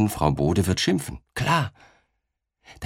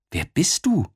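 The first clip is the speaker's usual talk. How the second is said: put-on child asking; 10 semitones higher than usual. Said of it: "fragt Paul".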